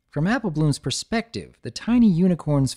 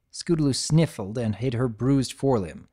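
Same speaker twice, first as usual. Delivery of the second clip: ordinary delivery, nothing marked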